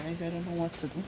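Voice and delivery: usual speaking voice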